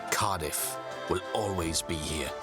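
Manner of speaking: ordinary delivery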